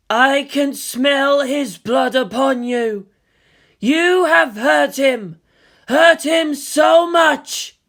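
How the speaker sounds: slow, looming, swelling to a shout; an eerie being grieving and threatening; otherworldly girl voice. Acted. Ayanami speaks into their minds.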